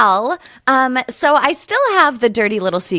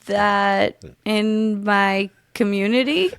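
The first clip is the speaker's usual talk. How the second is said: slow, halting word by word; tentative, broken-up reading; sounding it out. Shown as a demonstration. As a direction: puzzle it out, rising to a question at the end. Puzzling out the phonetic sentence.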